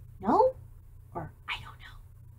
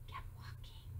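soft whispered repeat; whispered echo; 24 dB quieter than usual. Shown as a demonstration. Reader whispers the repeat to children. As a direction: whisper it like a secret.

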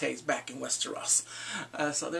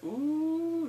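high, drawn-out mock intrigue; gossipy ooh; 11 semitones higher than usual. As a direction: let the ooh soar teasingly. Gossipy reaction voiced for Varys.

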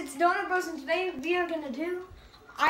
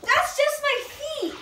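high, slow disbelieving cry; high shocked cry; 10 semitones higher, 6 dB louder, slower than usual. Real, not acted. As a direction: squeal it in cold disbelief. Feet in ice water.

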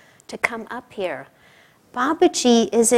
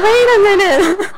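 high, loud laughing disbelief; 6 semitones higher, 5 dB louder than usual. Real, not acted. Pilgrim mocking her own impatience in the cave, laughing. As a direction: laugh at yourself mid-sentence.

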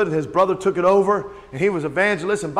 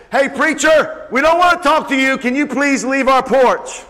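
raised, higher pleading shout, imitated; calling out; 5 semitones higher, 4 dB louder than usual. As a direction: call from the window to the porch. Boys call out to the preacher.

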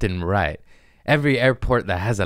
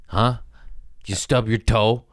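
normal talking voice

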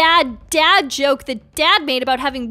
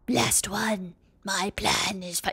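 low, hushed villain voice; scheming villain; 7 semitones lower, 10 dB quieter, slower than usual. Acted. Villain repeats his plan, in a sinister whisper.

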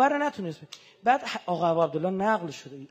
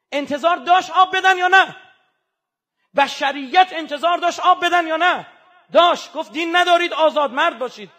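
loud, higher, impassioned sermon cry; full-force preaching; 4 semitones higher, 9 dB louder than usual. Acted. Preacher denouncing the killers before the congregation, shouting. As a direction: thunder at the enemies of the child.

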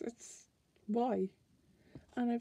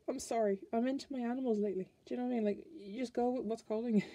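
ordinary talk, nothing marked

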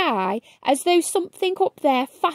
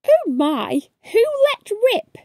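shocked; 7 semitones higher than usual. Acted. Shocked reaction to a smell.

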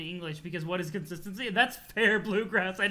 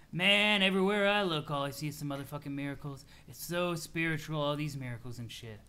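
laid-back troll, awestruck; 3 semitones lower than usual. Acted. Troll rambles to friend about miracles, mellow awe. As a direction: marvel along in a hazy, easygoing way.

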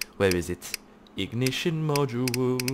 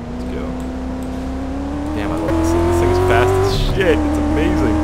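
much higher, louder delighted yells; thrilled shouting; 6 dB louder than usual. Real, not acted. Loves the fast car.

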